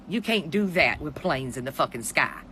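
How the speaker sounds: ordinary talk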